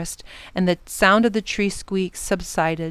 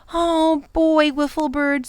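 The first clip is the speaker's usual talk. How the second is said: put-on higher boyish voice; young Ben; 7 semitones higher than usual. A narrator's reading. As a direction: speak to the bird as a boy. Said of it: "said Ben".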